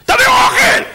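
very high, demanding shout; hammed-up high-pitched bellow; yelling for food. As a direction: holler it like a famished giant eater. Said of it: "ככה הוא צועק".

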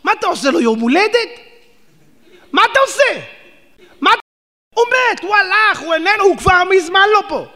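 scolding the mourner over a dead man; heated shout. Real, not acted. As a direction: preach with scornful force.

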